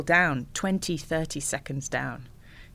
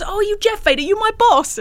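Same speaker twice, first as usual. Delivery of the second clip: high, loud fanboy voice; starstruck fan; 9 dB louder than usual. Retold or imitated. Fan in the canteen queue gushing at Vader, high-pitched. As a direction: gush like an excited fan in a queue. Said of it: "And he goes".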